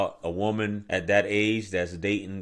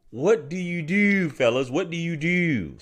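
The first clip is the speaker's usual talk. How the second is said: imitated Baltimore accent; 7 semitones higher than usual. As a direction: stretch "do" out long, Baltimore style. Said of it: "How y'all be stretching it out".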